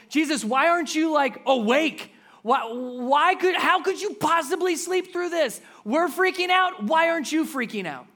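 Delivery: high, loud, frantic questions; panicked disciples. Retold or imitated. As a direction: sound like you're freaking out. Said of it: "asked it in the way of".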